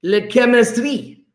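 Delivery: put-on playful French accent; mock French accent. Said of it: "Chemists can always speak French".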